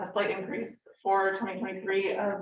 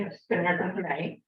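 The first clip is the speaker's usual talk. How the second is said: ordinary delivery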